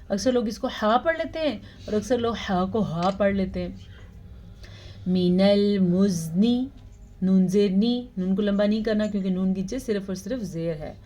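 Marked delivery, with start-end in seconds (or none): 5.1-6.6 slow melodic tajweed chant; measured Quranic recitation. Shown as a demonstration. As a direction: recite slowly, drawing out each vowel. Recitation demo.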